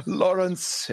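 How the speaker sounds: gasp, then high-pitched excited greeting; raven's delighted greeting. Acted. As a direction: answer like an overjoyed bird. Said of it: "the bird says back in response".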